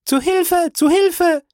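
very high-pitched scream; screaming for help. A narrator's reading. Young lady rings the bell for help, screaming. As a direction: scream out in alarm. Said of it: "schrie Fräulein d'Armilly".

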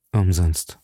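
low, falling, hopeless word; grim resignation. A narrator's reading. Athos declares all help useless.

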